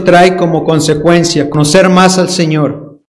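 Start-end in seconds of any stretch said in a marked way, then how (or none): none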